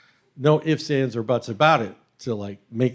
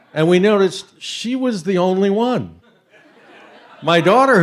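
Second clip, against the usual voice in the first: laughing; 7 semitones higher, 8 dB louder than usual. Real, not acted. Laughing at the punchline.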